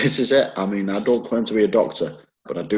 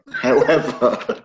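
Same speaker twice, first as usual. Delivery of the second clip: slow, higher word through laughter; real giggles breaking into the word; laughing; 6 semitones higher, much slower than usual. Real, not acted. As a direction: crack up as you try to carry on. Cracking up at own story.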